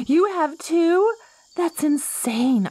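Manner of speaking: high, slow disbelief; astonished. Acted. Plant woman learning the traveler has two names, astonished. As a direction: stretch the words in amazement.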